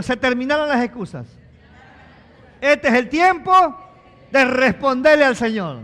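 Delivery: full-force pulpit cry; fiery preaching. Acted. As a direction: drive it home to the congregation.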